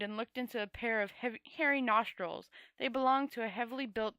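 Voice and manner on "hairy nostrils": plain reading voice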